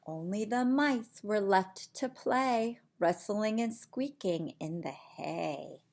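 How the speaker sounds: slow, hushed bedtime storytelling; sleepy bedtime reading. Acted. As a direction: lull them toward sleep. Bedtime ending of the book.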